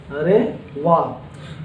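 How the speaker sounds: अरे वाह stretched long and soft, admiring; slow, admiring अरे वाह; drawn-out wow. Shown as a demonstration. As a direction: savour अरे वाह slowly like real delight. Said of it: "मतलब हो जाएगा".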